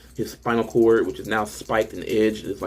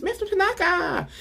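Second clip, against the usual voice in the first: very high screaming cry of a name. Retold or imitated. Devil sees the severed head still alive and shrieks high. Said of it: "the devil screams".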